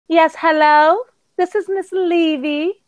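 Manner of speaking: high, slow phone voice imitating mom; business voice.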